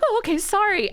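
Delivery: high, meek backpedalling apology; high mock-sheepish sorry; sheepish nurse. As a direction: imitate the nurse shrinking back. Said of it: "she was like".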